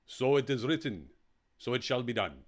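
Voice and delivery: lightly voiced royal decree; mock pharaoh quote. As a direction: pronounce it like a movie pharaoh. Quotes Brynner's Pharaoh film line.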